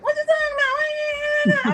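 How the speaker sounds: imitated high-pitched whiny wail; slow, squealed mock whine; whimpering nephew. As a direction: mimic his upset whimper, very high and drawn out. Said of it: "his little whimper was like".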